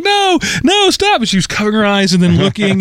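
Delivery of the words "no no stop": put-on much higher, a child begging the show to stop; father imitating his daughter's frightened plea, much higher; little girl pleading. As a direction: squeak it out like a scared three-year-old. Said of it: "She was like".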